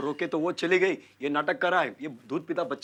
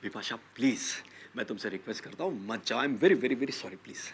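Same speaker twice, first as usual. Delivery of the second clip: low, soft entreaty; pleading; 7 semitones lower, 4 dB quieter than usual. Acted. Husband asks his wife to stay, pleading. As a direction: beg gently and apologetically.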